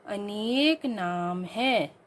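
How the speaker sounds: very slow reading of the wrong sentence; slow dictation. Shown as a demonstration. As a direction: read each word slowly for pupils. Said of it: "शुद्ध रूप में बोला जाएगा". (धीमा पाठ)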